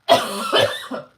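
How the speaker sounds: hard, loud real coughing; coughing fit. Real, not acted. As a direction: cough it out, choking. Choked on a drink.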